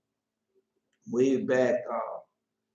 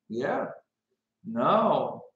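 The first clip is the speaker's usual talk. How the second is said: ordinary talk, nothing marked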